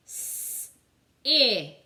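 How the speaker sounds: s hissed unvoiced, I said loud; segmenting sounds. Shown as a demonstration. Teacher breaking sip into sounds for the class. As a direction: separate s from I clearly. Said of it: "What makes the I sound?".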